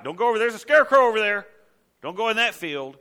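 hammed-up panic about a harmless scarecrow; mock alarm. Preacher imitating someone warning friends off a scarecrow field, mock alarm.

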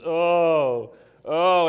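drawn-out sick moaning; hammed-up groan; mock moan. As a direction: moan like a sulky patient in bed. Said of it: "I can lay in bed and moan".

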